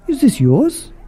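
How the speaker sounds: high, hopeful frog lady; high questioning frog voice; Missus Frog offering. Acted.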